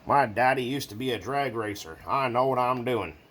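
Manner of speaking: hammed-up Southern boast; mock American accent. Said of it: "Doesn't have to be an American accent".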